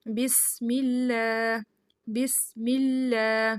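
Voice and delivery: بسم اللہ with a light doubled لام held; slow بسم اللہ, pause on the shadda; thin lam held. Shown as a demonstration. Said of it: "تھوڑی دیر ٹھہر کے اس کو ادا کرنا ہے". (شد پر ٹھہر کر باریک لام)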